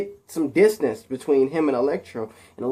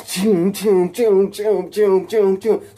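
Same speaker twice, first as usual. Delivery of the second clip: repeated higher, louder zap noises; blaster sound effects; 6 semitones higher, 5 dB louder, much slower than usual. A narrator's reading. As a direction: fire off zaps with your mouth. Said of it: "And he goes".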